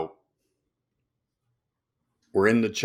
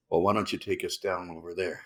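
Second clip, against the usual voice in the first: lower, softer, laid-back reply; Hasselhoff low and soft; 8 semitones lower, 7 dB quieter than usual. Retold or imitated. Dave replying to the loud pilot, low and soft. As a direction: answer low under your breath. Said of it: "Why are you talking so loud?".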